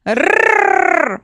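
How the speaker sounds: "Rrrr" as a long high rolled trill; Russian R. Shown as a demonstration. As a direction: roll "Rrrr" hard with the tongue tip. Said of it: "Do you feel the tongue?".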